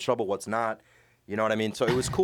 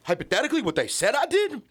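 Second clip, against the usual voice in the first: high, loud mock storyteller voice, dumb hypothetical cadence; 9 semitones higher, 4 dB louder than usual. Cellmate explaining his brass theft, in a high dumb cadence. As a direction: ham up a guy spinning a dumb hypothetical alibi. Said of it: "talking in the dumbest hypothetical cadence".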